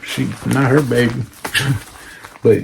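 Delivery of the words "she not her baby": ordinary delivery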